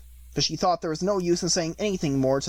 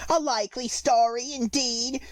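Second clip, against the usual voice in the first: contempt; 8 semitones higher than usual. A narrator's reading. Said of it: "in a tone of the deepest contempt".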